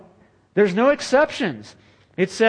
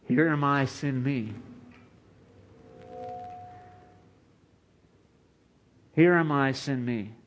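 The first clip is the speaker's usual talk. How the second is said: soft, falling, reverent answer; hushed reply; 2 semitones lower, 6 dB quieter than usual. Acted. Isaiah answering God's call, hushed and reverent. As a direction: answer quietly, in awe.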